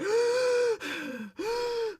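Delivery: big high-pitched excited gasp; hammed-up squealing intake of breath; inner fangirl gasp. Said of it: "inside I'm just like".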